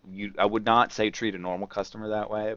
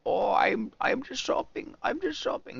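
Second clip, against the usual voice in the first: mock nervous thief, pitched way up; slower than usual. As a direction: play the caught thief, all innocence.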